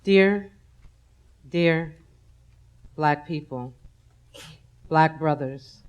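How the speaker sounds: low, soft, choked-up confession; tearful apology. Real, not acted. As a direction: let the grief pull the voice down.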